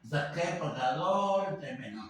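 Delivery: ordinary talk, nothing marked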